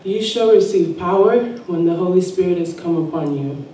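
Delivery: normal talking voice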